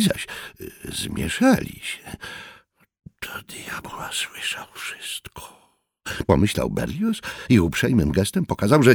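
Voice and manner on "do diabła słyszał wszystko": slow whispered curse of alarm; whispered thought. A narrator's reading. Berlioz's alarmed inner thought.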